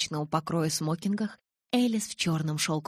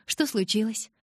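higher, cheerful enquiry; friendly, pitched-up curiosity; smiling question; 5 semitones higher than usual. A narrator's reading. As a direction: ask lightly with a smile. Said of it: "улыбнулась я".

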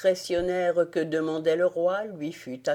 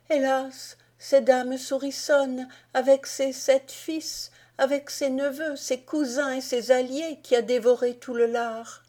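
higher queen's voice confessing; a queen lamenting, much higher; distressed queen; 8 semitones higher than usual. A narrator's reading. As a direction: confess the disaster, pitched up. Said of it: "répondit la reine".

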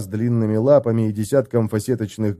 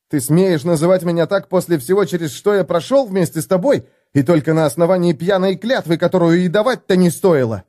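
higher, indignant protest; outraged reproach; 6 semitones higher, 3 dB louder than usual. A narrator's reading. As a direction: demand how he dares. Felix outraged at Gotrek. (Феликс возмущённо упрекает Готрека)

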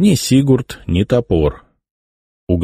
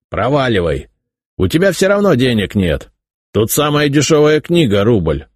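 put-on creaky old shopkeeper shooing a boy; scratchy voice, brushing him off; very old man; 6 semitones higher than usual. A narrator's reading. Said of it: "скрипучим голосом".

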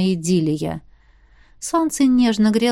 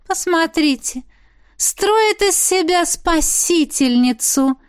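high-pitched, slow, disdainful female character voice; disdainful young woman; 7 semitones higher, slower than usual. A narrator's reading. Olivia mocks the girl playing rescuer, high scornful drawl.

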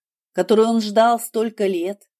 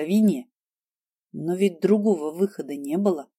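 ordinary delivery

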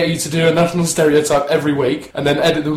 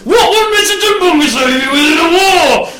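high, loud, exasperated question; yelled prompt; 10 semitones higher, 6 dB louder than usual. Pretend. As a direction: shout the question at the actor. Co-host yells prompt at actor.

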